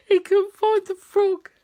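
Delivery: laughing. Real, not acted. Repeat, laughing higher.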